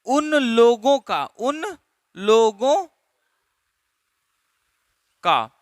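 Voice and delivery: drawn out slowly, word by word; slow, spelled-out phrase. Shown as a demonstration. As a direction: stretch उन लोगों का slowly for the class. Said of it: "अगर बोलना है".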